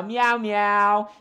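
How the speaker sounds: put-on drawn-out meows for children to copy; singsong cat call, rising at the end; meowing along. Shown as a demonstration. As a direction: lead everyone in meowing. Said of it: "Everybody say".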